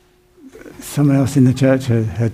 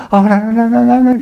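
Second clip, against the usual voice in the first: high, rising mock panic, getting louder; panicked no; 10 semitones higher, 3 dB louder than usual. Pastor imitating the lady panicking about the church, in rising mock panic. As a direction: squeal it out like a horrified churchgoer, building up. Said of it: "she goes".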